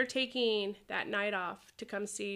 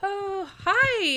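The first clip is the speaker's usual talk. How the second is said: surprised hi; 11 semitones higher, 9 dB louder than usual. Retold or imitated. Surprised greeting to fans.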